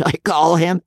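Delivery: high, coarse cackle of a laugh; cackling baronet. Acted. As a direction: laugh it off like a crude old lord. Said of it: "Hodson laughed too".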